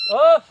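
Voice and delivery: frustrated loud "of"; loud exasperated groan. Real, not acted.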